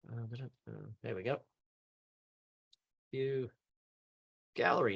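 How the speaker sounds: low-key, very soft self-talk; absent self-talk. Real, not acted. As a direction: murmur to yourself while working the screen. Hunting screen controls to himself.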